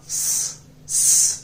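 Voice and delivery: स hissed with no vowel, a bare unvoiced sss; just the hiss of स, no vowel at all; half s sound. Shown as a demonstration. Teacher to students, showing half स as a bare hiss. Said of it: "लेकिन आधा".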